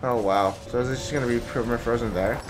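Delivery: usual speaking voice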